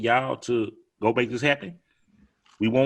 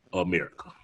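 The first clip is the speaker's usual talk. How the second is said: normal talking voice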